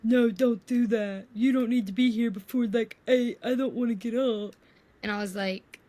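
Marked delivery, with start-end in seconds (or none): 0.0-4.5 put-on sleepy boyfriend voice. Girlfriend imitates boyfriend not wanting to wake early. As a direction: imitate him begging to sleep in. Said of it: "And he's like".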